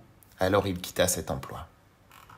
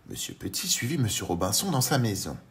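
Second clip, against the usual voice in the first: ordinary delivery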